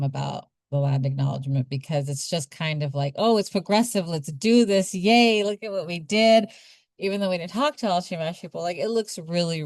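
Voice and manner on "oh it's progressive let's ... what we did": mock self-praise. Mocking self-congratulating institution.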